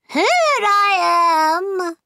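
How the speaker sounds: high, long drawn-out call; crying out. A narrator's reading. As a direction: sing out each word, high and loud. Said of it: "she cried".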